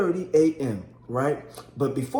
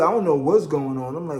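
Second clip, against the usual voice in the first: ordinary delivery, nothing marked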